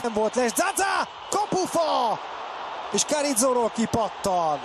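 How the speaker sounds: high shout off the post; excited hungarian sports commentary. Real, not acted. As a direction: cry out as it hits the post. Shot hits the post.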